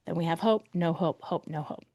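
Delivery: describing hope flickering out, fading to nothing. Real, not acted. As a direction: let each "no hope" die away.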